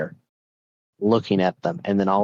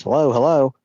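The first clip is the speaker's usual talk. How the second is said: higher, insistent phone hello; calling into phone; 5 semitones higher, 4 dB louder than usual. Acted. Husband picking up the phone, calling out hello. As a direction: call down the line for an answer.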